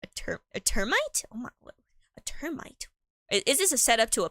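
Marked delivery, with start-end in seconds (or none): 0.0-2.9 baffled whisper. Real, not acted. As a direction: hush it out in disbelief. Startled by a strange item.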